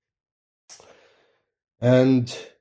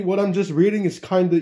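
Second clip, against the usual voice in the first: ordinary delivery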